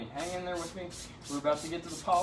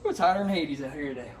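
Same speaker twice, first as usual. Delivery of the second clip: usual speaking voice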